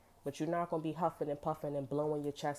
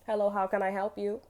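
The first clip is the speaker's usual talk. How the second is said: higher, louder receptionist voice; customer-service greeting; 5 semitones higher, 6 dB louder than usual. Acted. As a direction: greet the caller brightly and eagerly. Acts out answering a service call.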